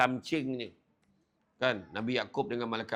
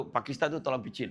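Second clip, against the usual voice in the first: ordinary delivery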